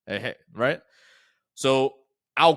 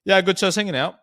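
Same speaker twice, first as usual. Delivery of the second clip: a lightly voiced prospect, higher and louder; casual caller reply, a touch raised; relaxed prospect; 7 semitones higher, 7 dB louder than usual. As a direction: play the easygoing lead picking up. Prospect answering the sales call.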